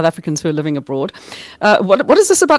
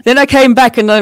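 usual speaking voice

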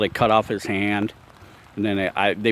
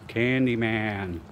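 slow, drawn-out, softer Candyman; spooky slow summons; 4 dB quieter, much slower than usual. Shown as a demonstration. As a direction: call Candyman slowly like a ritual. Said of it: "So I'm gonna do Candyman".

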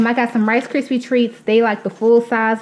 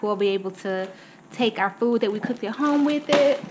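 normal talking voice

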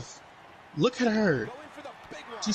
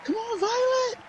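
excited cheer; 13 semitones higher than usual. Real, not acted. Cheering on her wrestler.

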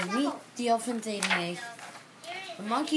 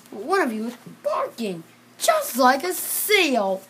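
puzzled teacher; 4 semitones higher, 8 dB louder than usual. Acted. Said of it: "said the teacher".

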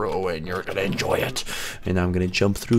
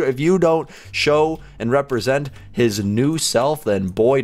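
normal talking voice

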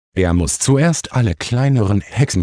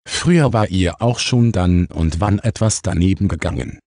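plain reading voice

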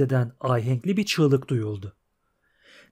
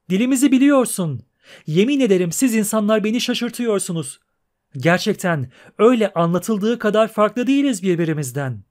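high, loud, scornful singsong; shrill trilling mermaid; 6 semitones higher, 4 dB louder than usual. Acted. Mermaid scorning the absent prince and teasing the pair, high and shrill. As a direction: trill her disgust like a spoiled siren.

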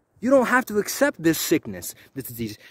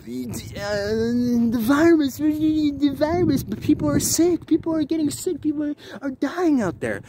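high mock panic; mocking a worrier; 8 semitones higher than usual. Mocking someone warning about people dying. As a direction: whine it like a scaremonger. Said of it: "if someone says to you".

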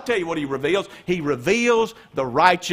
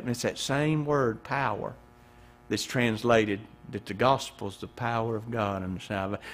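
ordinary talk

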